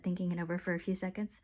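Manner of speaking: ordinary delivery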